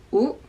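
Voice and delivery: U kept short, lips rounded; quick rounded U. Shown as a demonstration. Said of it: "you round the lips".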